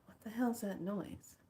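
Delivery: retold hushed question to self; hushed puzzlement. Retelling waking to a strange noise, hushed puzzlement. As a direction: ask it softly, as if just woken.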